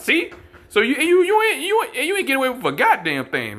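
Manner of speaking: fired-up preacher outburst; heated preaching. Real, not acted. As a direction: rise up hot against them. Preacher raises pitch in indignation.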